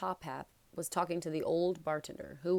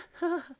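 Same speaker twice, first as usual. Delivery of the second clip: high, jittery little laugh; nervous laugh; 12 semitones higher, 4 dB louder than usual. A narrator's reading. Quirrell laughs nervously at his own joke, high nervous titter. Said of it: "he laughed nervously".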